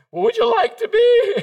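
very high, loud mock-eager question; hammed-up eager squeak; eager offer. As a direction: pipe it up like an overkeen young evangelist. Eager young evangelist answers biker.

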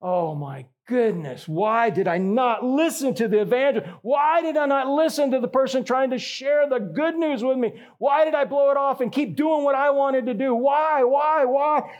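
rising, despairing cry of regret; regretful wail. Retold or imitated. Damned soul lamenting in hell, rising despairing wail. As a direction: plead with yourself in anguish, pitch climbing. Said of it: "they're weeping because they're like".

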